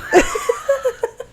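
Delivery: high giggling fit. Real, not acted. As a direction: crack up at your own accent. Breaks up laughing at attempt.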